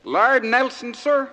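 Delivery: high, loud questioning call. Acted. Cab driver to George off the train, calling out. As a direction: call it out like a cabbie seeking a fare.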